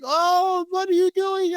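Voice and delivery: playful high-pitched impression; French friend voice. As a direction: squeal it out as the surprised friend. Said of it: "He's like".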